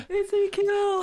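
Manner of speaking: loud, high, slow laughing cry; laughing shout. Real, not acted. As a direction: shout the name through laughter.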